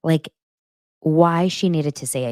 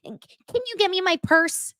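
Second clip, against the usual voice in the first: quoting Shannon. Retold or imitated. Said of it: "when Shannon was like".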